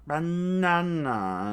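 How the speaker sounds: very slow, drawn-out strange cry; villain's attack cry. Acted. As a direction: stretch the word into a battle cry. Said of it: "Miss Merry Christmas screamed out".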